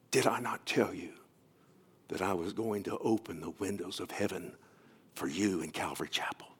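a quiet, intimate divine whisper; hushed voice of God. Acted. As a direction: speak it gently, like a voice in the heart. Preacher voices God speaking to heart.

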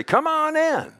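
high, sing-song call to come in; mock-friendly high-pitched invitation; cheery welcome. Acts out welcoming visitors at the door.

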